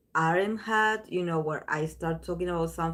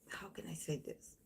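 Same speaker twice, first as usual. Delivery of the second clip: whispered aside to herself; a hushed, hesitant whisper; 18 dB quieter than usual. Real, not acted. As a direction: drop to a breathy whisper while searching for words. Searching for words, whispered.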